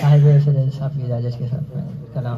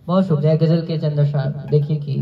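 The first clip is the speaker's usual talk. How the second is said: ordinary talk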